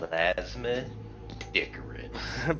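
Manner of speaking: breathy dramatic whisper. Acted. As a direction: whisper the name slowly.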